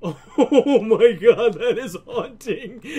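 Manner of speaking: slow, laughing through the words; laughing off a fright; startled laughter. Real, not acted. Sees his own face, cracks up.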